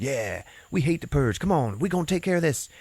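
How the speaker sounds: high, put-on voice of a cocky leader; rallying leader. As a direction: imitate a leader rousing his crew. Imitating leader rallying the group.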